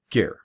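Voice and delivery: short neutral vowel between G and R; gear as just G-R. Shown as a demonstration. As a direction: clip gear to a short syllable. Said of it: "If it was just G R".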